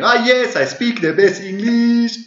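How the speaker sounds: high, loud, slow mock foreign accent; hammed-up proud foreign speaker; super confident foreigner. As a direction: imitate a booming, over-confident English learner. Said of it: "they're just super confidently going".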